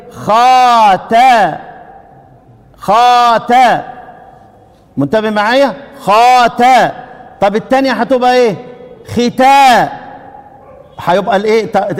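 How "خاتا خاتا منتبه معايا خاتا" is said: very slow خاتا with stretched alif; teacher drilling the long alif; long alif before ta. Shown as a demonstration. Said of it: "بتقدم الالف على التاء".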